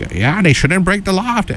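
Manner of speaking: lightly hammed Southern politician; Southern accent. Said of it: "I don't wanna do a Southern accent".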